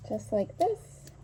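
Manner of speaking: muffled behind a mask; through a face mask. Real, not acted. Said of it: "I'm gonna have to talk through a mask".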